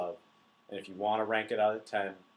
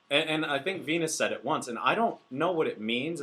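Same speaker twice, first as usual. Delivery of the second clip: usual speaking voice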